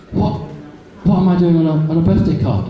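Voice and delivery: shocked disbelief. Retold or imitated. Man to wife in a card shop, half-acted shocked disbelief. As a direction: mildly act out finding your own face on a card. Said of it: "I was so shocked".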